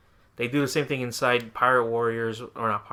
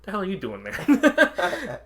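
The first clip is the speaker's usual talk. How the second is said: high, drawn-out, laughing disbelief; loud, stretched-out baffled question; mock bafflement; 8 semitones higher, 6 dB louder, slower than usual. Reacting to Trunks standing next to him, mock bafflement. Acted reaction to a game character.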